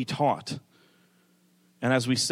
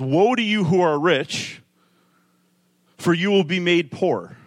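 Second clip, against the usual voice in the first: declaring woe; 7 semitones higher, 6 dB louder than usual. Acted. Preacher quoting Jesus' woe.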